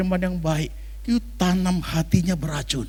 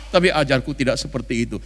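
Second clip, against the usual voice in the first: ordinary talk, nothing marked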